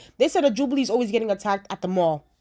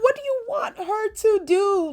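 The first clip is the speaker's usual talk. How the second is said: exasperated; 12 semitones higher than usual. Retold or imitated. Retorting, exasperated.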